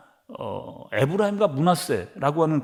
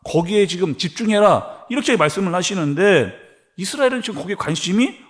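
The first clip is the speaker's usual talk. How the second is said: louder, higher preaching at full force; forceful preaching; 3 semitones higher, 4 dB louder than usual. Acted. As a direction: drive the sermon home with force. (힘차게 외치는 설교)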